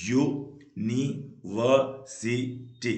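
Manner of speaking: University very slow, in five separate beats; syllable by syllable. Shown as a demonstration. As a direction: clear gaps between all five syllables of university. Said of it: "पांच syllable होते हैं".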